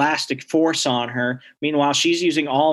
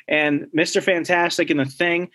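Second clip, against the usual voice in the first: ordinary talk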